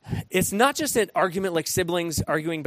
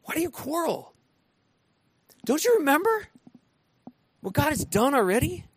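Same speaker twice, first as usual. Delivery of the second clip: pleading rebuke; 9 semitones higher than usual. Retold or imitated. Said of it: "He calls them on their spirit".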